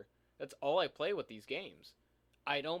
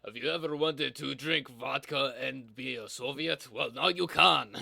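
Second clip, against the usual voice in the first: put-on slow Russian-accented ad pitch, louder at the end; 5 dB louder than usual. Host pitching a Soviet game to players, Russian accent. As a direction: sell it with a drawn-out Russian accent. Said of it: "keep working on my Russian accent".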